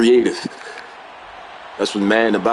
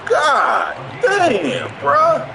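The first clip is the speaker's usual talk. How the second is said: high-pitched, exasperated complaining; exasperated outburst; 13 semitones higher, slower than usual. Real, not acted. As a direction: cry out in sheer disbelief. Streamer exasperated by opponent's game play.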